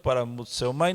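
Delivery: ordinary talk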